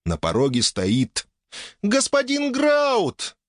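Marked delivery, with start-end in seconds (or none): 1.8-3.4 very high, slow, overjoyed cry; excited exclamation. Acted. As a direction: call his name, thrilled. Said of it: "с неописуемым букетом эмоций воскликнула".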